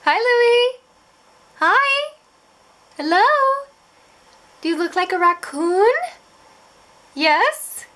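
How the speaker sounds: high, loud sing-song dog talk. Real, not acted. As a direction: coo at the puppy brightly. Greeting dog in baby talk.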